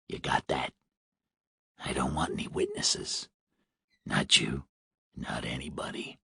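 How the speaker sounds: low hoarse smoker's whisper. A narrator's reading. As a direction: threaten in a rasping whisper. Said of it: "The man sounded hoarse, like a heavy smoker".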